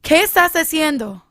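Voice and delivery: mother snapping at a stranger, loud and high; yelled at stranger. Retold or imitated. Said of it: "my mom got up and yelled".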